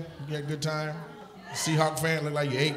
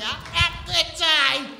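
high, loud, slow mock of a prim lady; mock prim old lady; 13 semitones higher, 4 dB louder, slower than usual. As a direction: squeal it out high and drawn out. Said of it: "She's like".

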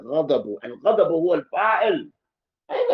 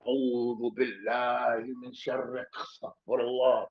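muttered exasperation; 6 semitones lower, 8 dB quieter than usual. Retold or imitated. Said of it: "you go".

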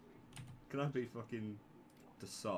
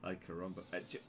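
ordinary talk

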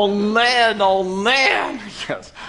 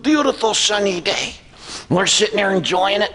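ordinary talk, nothing marked

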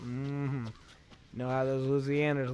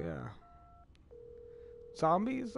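ordinary talk

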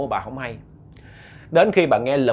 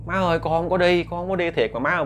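son insisting; 5 semitones higher than usual. Acted. Son insists he went nowhere.

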